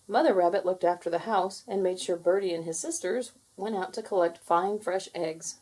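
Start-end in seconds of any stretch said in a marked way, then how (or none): none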